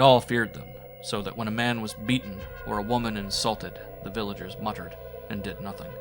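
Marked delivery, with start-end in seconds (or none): none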